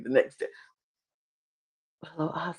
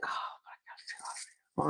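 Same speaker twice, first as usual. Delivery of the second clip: agonised whisper, barely any voice; conflicted whisper; 18 dB quieter than usual. Retold or imitated. Emily torn about not telling Ozzy, conflicted whisper.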